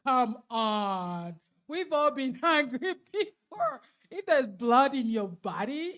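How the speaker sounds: loud, emphatic preaching. Acted. Speaker challenging the audience about anger, loud preaching. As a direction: rouse the room like a preacher.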